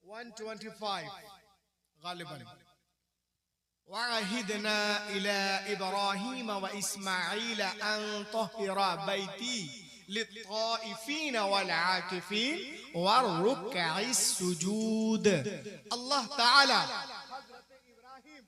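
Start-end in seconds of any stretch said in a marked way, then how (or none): 3.9-15.3 slow, chanted verse; measured, melodic recitation; Quranic recitation. Acted. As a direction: intone the verse slowly.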